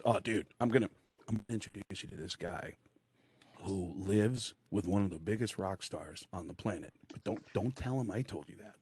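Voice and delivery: low, hushed insider's aside; confidential. Retold or imitated. Buddy quietly tipping off the newcomer about a rock star's housemate, confidential. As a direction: let them in on a secret, softly.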